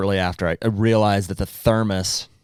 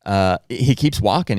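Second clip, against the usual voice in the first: ordinary delivery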